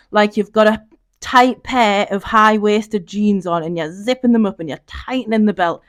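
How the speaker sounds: ordinary delivery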